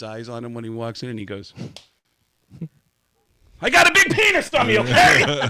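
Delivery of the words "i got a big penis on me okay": high, loud exasperated announcement; loud confession. Retold or imitated. Drummer facing his staring bandmates, loud high announcement. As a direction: blurt it out high and loud to the room.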